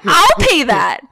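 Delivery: high, loud exasperated outburst; retold outrage. Daughter appalled at six months of tarp, retold outrage. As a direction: build to incredulous high-pitched protest.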